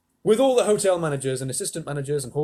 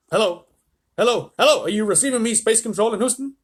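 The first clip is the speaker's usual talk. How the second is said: raised, loud repeated calls; radio hailing; 8 semitones higher, 7 dB louder than usual. A narrator's reading. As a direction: hail mission control urgently. Astronaut calls on crackling radio.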